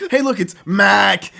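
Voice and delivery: loud, slow, in-your-face announcement; obnoxious popup voice. Pretend. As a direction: blare it like an annoying popup. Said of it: "real obnoxious".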